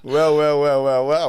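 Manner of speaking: loud, amused well well well. Real, not acted. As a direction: boom it out with amusement. Reacting to punchline.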